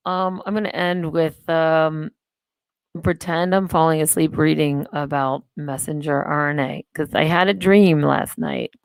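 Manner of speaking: ordinary talk, nothing marked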